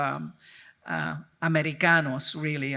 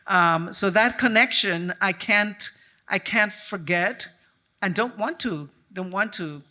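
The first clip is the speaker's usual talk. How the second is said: normal talking voice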